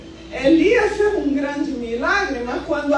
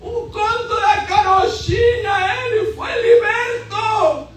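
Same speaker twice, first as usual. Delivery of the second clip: fiery preaching; 4 semitones higher than usual. Acted. Preacher proclaiming freedom to the church, full-force preaching. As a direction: preach it out to the congregation with fire.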